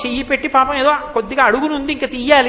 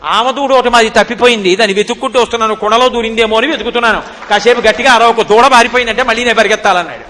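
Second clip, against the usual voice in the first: louder, indignant reprimand; angry scolding; 6 dB louder than usual. Acted. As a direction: scold her like the offended party. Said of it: "కోపంగా కనుబొమ్మలు ముడేసి అన్నాడు".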